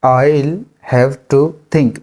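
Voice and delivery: drawn out slowly. Shown as a demonstration. Teacher models the sentence slowly. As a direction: say it slowly for learners. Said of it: "मुझे सोचना पड़ेगा".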